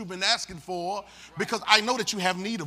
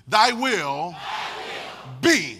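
call-and-response shout; 6 semitones higher, much slower than usual. Shown as a demonstration. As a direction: lead the congregation, punch each word. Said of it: "say it like me".

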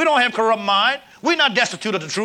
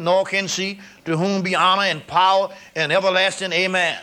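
scripture reading; 3 semitones lower than usual. Acted. Preacher reading scripture to his congregation, measured. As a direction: read the verses like a lesson. Reads long scripture passage aloud.

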